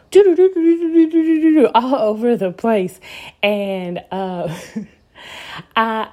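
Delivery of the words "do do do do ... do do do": high, loud rapid do-do-do chatter; frantic mental static in syllables; racing mind noise. Retold or imitated. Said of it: "my mind was like".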